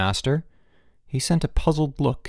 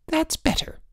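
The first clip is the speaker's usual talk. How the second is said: high-pitched, satisfied wife; triumphant. A narrator's reading. Wife wins the boys a reprieve, triumphant. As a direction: lift it high in victory. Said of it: "said his wife triumphantly".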